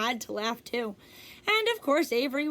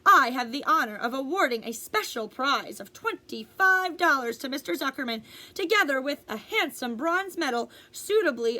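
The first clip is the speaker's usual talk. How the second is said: fair announcer; 2 semitones higher than usual. Acted. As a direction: swell with pride through each adjective. Loudspeaker award announcement.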